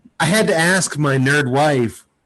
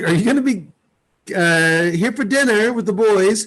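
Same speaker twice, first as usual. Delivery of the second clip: high-pitched wife asking about dinner; voicing the wife; 7 semitones higher than usual. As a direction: play the wife in a raised pitch. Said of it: "She's like".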